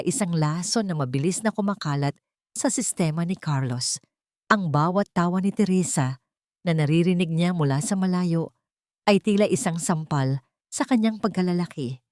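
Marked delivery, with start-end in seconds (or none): none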